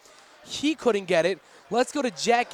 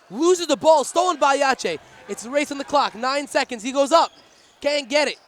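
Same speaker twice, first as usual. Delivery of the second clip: loud, higher, rising urgency; excited play-by-play; 6 semitones higher, 6 dB louder than usual. Acted. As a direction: call the last seconds breathlessly. Final seconds race, play-by-play.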